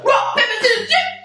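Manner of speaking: high, excited game chant rising to a cry; pretend-game squeal at the end; excited play. Playing a hand game with imagined friend.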